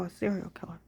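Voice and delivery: a secret murmured aside; whispered reveal. Real, not acted. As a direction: drop to a whisper on a serial killer.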